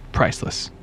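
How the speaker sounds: breathy whispered punchline. Pretend. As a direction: whisper it like an ad tagline. Ad-style punchline after fashion joke.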